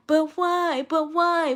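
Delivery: put-on whiny kid. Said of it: "I don't sit around and say".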